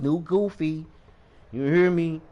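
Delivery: ordinary talk, nothing marked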